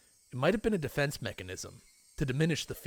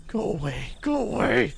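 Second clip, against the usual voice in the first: from plain to much louder shout; building yell; 9 semitones higher, 7 dB louder than usual. Acted. Keiichi yelling at Rena, building to a shout. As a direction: escalate into a panicked scream.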